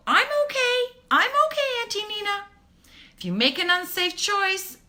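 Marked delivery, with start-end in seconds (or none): 0.1-2.4 put-on high squeaky child insisting she's fine; little girl voice. Imitating the little girl brushing off her auntie's warning. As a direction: chirp it like a stubborn toddler. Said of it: "And they go".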